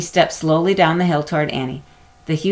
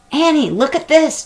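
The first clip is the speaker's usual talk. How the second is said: raised, excited call to his sister; 11 semitones higher, 4 dB louder than usual. A narrator's reading. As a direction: call her over, eager. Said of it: "Jack called".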